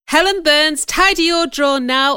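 loud, high-pitched command; bossy order. Acted. Girl coming in to threaten Helen, loud and bossy. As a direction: call out the order sharply.

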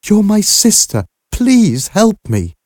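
very high, desperate begging; tearful plea. A narrator's reading. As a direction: beg a sister in anguish, voice way up. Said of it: "could hear Aunt Harriet crying".

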